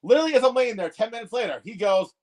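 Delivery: ordinary talk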